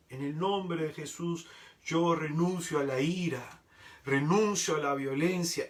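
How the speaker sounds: ordinary talk, nothing marked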